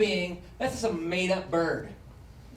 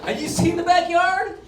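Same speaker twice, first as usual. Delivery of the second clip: loud defiant; 9 semitones higher, 6 dB louder than usual. Acted. Defiant squatter rant.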